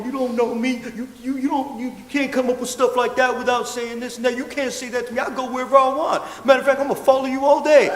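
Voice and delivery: high, sputtering mock rant; indignant telling-off. Dog walker telling off an old woman in the street, indignant.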